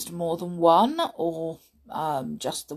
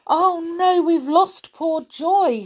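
high, drawn-out storybook dismay; upset; 12 semitones higher, 8 dB louder than usual. A narrator's reading. Robin tells the others Joy is lost, upset cry. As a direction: wail it lightly in acted distress. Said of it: "said Robin, upset".

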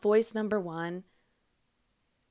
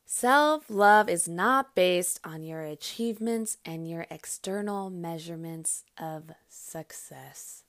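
slow emphatic restatement; 3 semitones lower than usual. Shown as a demonstration. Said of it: "Whew. That one".

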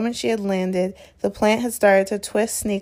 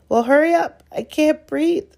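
high, strained and desperate; Harry struggling for breath in the vines; gasped; 7 semitones higher, 4 dB louder than usual. A narrator's reading. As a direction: gasp it out while being squeezed. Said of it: "Harry gasped".

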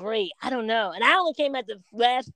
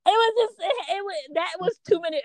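overcome, near tears; 9 semitones higher than usual. Retold or imitated. Retelling seeing the man who looked like her late uncle, voice breaking. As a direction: voice jumps up and breaks as the tears come. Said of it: "I was like".

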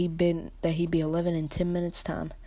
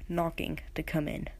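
plain reading voice

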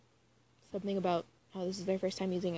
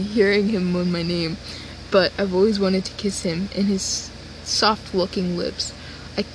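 low loud reading; deep voice; 9 dB louder than usual. Acted. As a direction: read it deep and heavy. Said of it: "My octaves are dropping".

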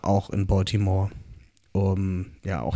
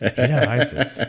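laughing; 4 semitones higher, 4 dB louder than usual. Real, not acted. Laughing at his own joke.

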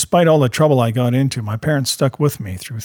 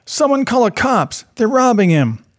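high-pitched bystander's cry for help; imitated yell; 10 semitones higher, 3 dB louder than usual. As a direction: re-enact a yell across the street, raised pitch without full volume. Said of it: "I can remember him yelling".

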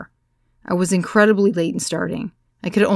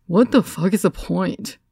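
mild mock shout. A narrator's reading. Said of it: "the tallest Greek in the room shouted from the back".

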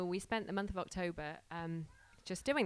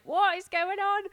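much higher, loud wonder; amazed; 11 semitones higher, 10 dB louder than usual. Retold or imitated. The couple marvelling at what God is doing, high and loud. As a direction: exclaim in delighted disbelief.

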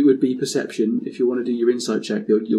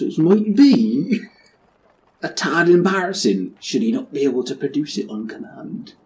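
West Country pirate; 2 semitones higher than usual. Acted. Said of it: "Ham it up".

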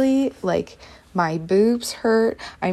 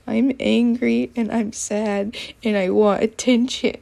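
slow, whiny plea for attention; 3 semitones higher than usual. Retold or imitated. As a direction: whine it out slowly, exaggerating your own sulk. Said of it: "And I just whined it".

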